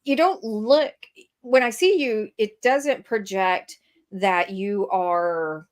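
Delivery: ordinary talk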